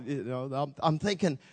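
ordinary delivery

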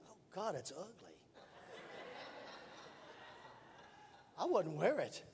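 a private dry verdict, kept low; muttered inner thought. Retold or imitated. Private thought about ugly jacket.

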